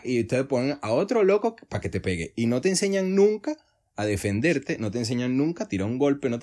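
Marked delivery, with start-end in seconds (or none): none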